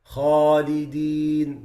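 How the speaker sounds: slow Khalidin with an open heavy alif; correct Khalidin. Shown as a demonstration. Teacher showing students the right Khalidin. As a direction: say Khalidin slowly with lips unrounded. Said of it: "These are wrong pronunciations".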